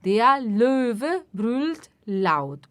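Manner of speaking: very slow, clear and even; slow model sentence. Shown as a demonstration. Teacher modelling sentence slowly for repetition. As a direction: spell it out slowly for learners. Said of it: "teacher models sentence".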